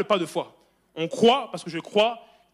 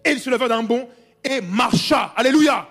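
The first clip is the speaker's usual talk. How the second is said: full-force preaching; 6 semitones higher, 8 dB louder than usual. Acted. Preacher to congregation about Paul, loud fervent preaching. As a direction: proclaim it to the back row.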